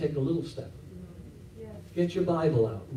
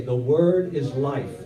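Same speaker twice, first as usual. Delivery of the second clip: slow, louder "The word is life"; call and response; 3 semitones lower, 4 dB louder, slower than usual. Shown as a demonstration. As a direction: model it for the church to repeat. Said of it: "Somebody say".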